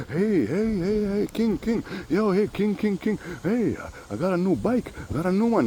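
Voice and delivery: an excited man at the window wanting attention; neighbor calling out. Retold or imitated. Davo at the window, high eager voice calling King. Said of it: "Davo by my window".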